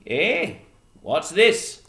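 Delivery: high, loud fisherman voice; puzzled fisherman. Acted. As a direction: call out gruff curiosity as a sailor. First fisherman finds rabbit.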